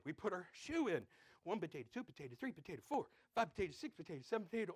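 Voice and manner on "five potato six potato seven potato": ordinary talk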